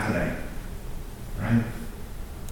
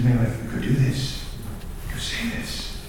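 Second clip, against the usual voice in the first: soft prompting; 2 semitones lower, 9 dB quieter, slower than usual. Retold or imitated. Voicing the Spirit's prompt.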